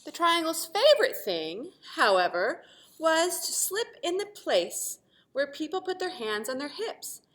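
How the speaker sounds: put-on lively read-aloud for children, bright and animated; storytelling voice. A narrator's reading. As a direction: read it like a picture book to a class. Teacher reads story aloud.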